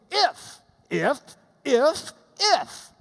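IF hit hard and high each time; punchy repeated IF. Retold or imitated. Teacher voices Paul hammering his clauses, punched IF. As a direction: punch every IF separately, loud and high. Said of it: "So Paul's saying".

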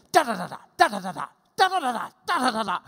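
rapid bursts of DA, punched at the start; rat-a-tat dictation. Acted. Teacher acts Paul dictating, rat-a-tat. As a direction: rattle it off in drumming bursts. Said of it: "see Paul dictating this".

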